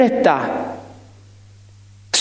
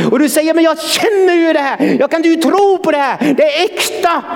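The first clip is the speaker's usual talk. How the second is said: higher, fervent preacher's call; preaching at full force; 8 semitones higher than usual. Acted. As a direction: preach it out to the congregation.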